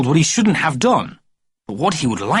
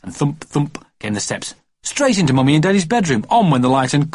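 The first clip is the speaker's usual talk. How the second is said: plain reading voice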